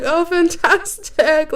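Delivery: high, slow, drawn-out mock delight; forced enthusiasm. Being nice while wanting to leave, forced enthusiasm. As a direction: stretch out a put-on polite cheer.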